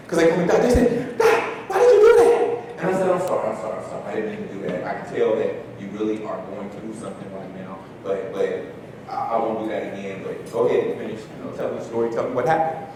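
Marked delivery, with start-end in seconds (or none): 1.2-2.8 much higher, louder startled student voice; startled question. Retold or imitated. Startled student asks counselor. 3.1-12.9 lower, softer soothing apology; calm her down and invite her to go on; gentle apology. Retold or imitated. Counselor apologizes, invites story.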